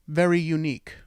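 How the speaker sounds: ordinary talk, nothing marked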